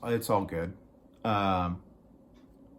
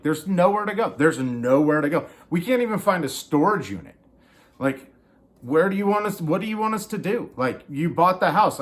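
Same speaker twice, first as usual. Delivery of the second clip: higher and louder, exasperated; exasperated plea; 6 semitones higher, 4 dB louder than usual. Retold or imitated. Tenant pleading with the landlord, loud and exasperated. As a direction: push back hard, voice climbing.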